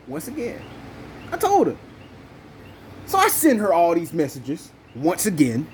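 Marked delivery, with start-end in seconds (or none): 1.3-1.7 I told her. Real, not acted. Venting, high pitched outrage.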